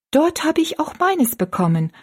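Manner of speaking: put-on high, eager girl's voice; high-pitched exclaiming child; excited exclamation. A narrator's reading. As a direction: pipe it up as excited young Rosalie, without extra volume. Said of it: "rief Rosalie".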